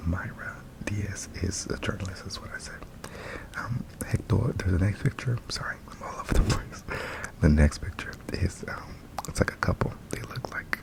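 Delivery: breathy ASMR whisper. Real, not acted. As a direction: whisper it close to the mic.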